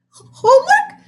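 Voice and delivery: very high, loud squeak. A narrator's reading. The old student squeaking at his strict teacher, very high. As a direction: squeak the word in panic. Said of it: "squeaked Mister Jupiter".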